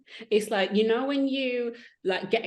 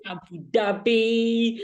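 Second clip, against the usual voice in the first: loud, slow, drawn-out dread; dramatic dread; 4 semitones higher, 5 dB louder, much slower than usual. Real, not acted. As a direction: stretch the name out, high and heavy with dread. Dreading the coming last race.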